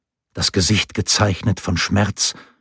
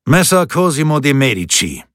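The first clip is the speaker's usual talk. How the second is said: booming, higher captain's call; 7 semitones higher, 4 dB louder than usual. A narrator's reading. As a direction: boom out his name. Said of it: "dröhnte der Hauptmann".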